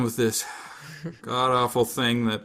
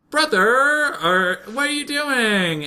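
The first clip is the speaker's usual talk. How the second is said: high, loud mock sister voice; drawn-out, high-pitched mock protest; scandalized sister; 10 semitones higher, 4 dB louder, slower than usual. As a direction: whine it like a shocked anime sister. Voices sister catching brother.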